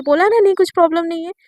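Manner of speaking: loud acted irritation, pushed out on the first words; irritated insistence. Shivani answering Naina's repeated question about a problem, irritated insistence. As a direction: raise your voice to end the questioning. Shivani दोबारा पूछने पर झल्लाई.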